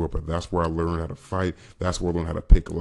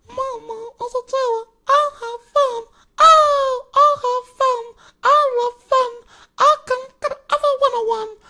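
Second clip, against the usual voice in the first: put-on squeaky falsetto child, louder with whiny wails; high childlike voice; 7 dB louder, slower than usual. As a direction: play a little kid whining happily to mama. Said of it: "make my voice really high if I want to".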